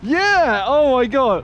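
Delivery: excited yell. Real, not acted. Whooping after a drift.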